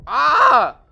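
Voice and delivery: loud startled scream; sudden cry of pain. Real, not acted. Hit by explosion, low health.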